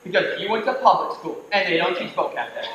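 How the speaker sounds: ordinary talk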